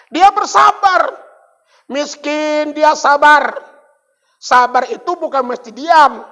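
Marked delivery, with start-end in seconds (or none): none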